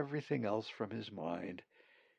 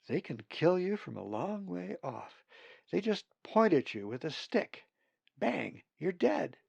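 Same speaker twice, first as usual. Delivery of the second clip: put-on higher, earnest warning to a young bird; cautioning; 8 semitones higher than usual. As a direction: warn him it's dangerous. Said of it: "Molly cautioned".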